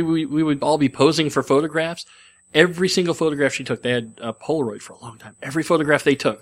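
normal talking voice

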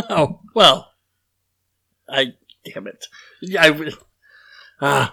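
ordinary talk, nothing marked

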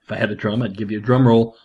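usual speaking voice

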